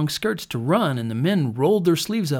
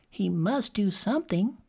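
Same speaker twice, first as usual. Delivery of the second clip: put-on child; 7 semitones higher, 5 dB quieter than usual. A narrator's reading. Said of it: "agreed another".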